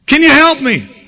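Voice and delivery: frantic high call upward; desperate plea. Retold or imitated. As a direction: beg for help, still hanging from the brush. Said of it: "And in desperation".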